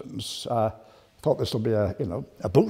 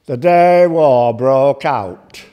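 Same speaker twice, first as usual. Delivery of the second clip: slow, loud, doom-laden recitation; slow mock-mournful loud opening; Albert Motley opening; 8 dB louder, much slower than usual. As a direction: toll it out like a gloomy comic. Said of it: "All his recitations used to begin".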